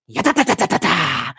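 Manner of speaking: rapid nonsense-syllable run; mock stuttering noise. Relishing the look on her face, silly imitated noise. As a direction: rattle off a silly string of syllables.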